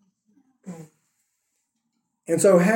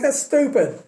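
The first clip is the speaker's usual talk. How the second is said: higher-pitched imitation of a scoffer; mock dismissal; 5 semitones higher than usual. Israelites brush off Moses' cure for snake bite, mock dismissive. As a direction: voice an incredulous doubter, pitched up.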